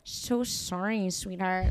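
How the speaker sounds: over-sibilant S in "so sorry, sweetheart"; slow, soft "so sorry" with long hissing S's; exaggerated S's. Shown as a demonstration. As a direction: linger on every S in "sweetheart". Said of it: "those people that make their S's, like, really".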